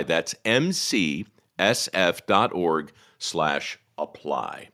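each letter slowly and clearly; slow spelled-out address. Shown as a demonstration. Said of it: "repeats web address slowly".

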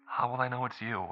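put-on high, soft child voice; little girl. Young daughter asking her father on the phone, high child voice. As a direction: sound small and eager, like a young girl.

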